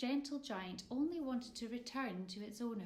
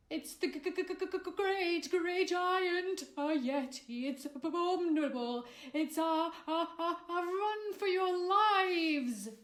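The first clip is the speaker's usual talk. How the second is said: high, loud, stammering panic; terrified scream; 9 semitones higher, 10 dB louder than usual. A narrator's reading. McFearty screaming at the yeti in terror. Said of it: "screamed at the top of his voice".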